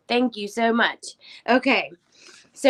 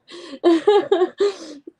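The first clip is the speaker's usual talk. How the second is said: tearful laugh; 9 semitones higher, 6 dB louder than usual. Real, not acted. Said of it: "Y'all gotta stop".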